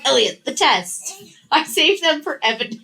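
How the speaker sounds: giggling through the line; retelling through giggles; laughing quote. Retold or imitated. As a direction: laugh while quoting her. Retold quote while laughing.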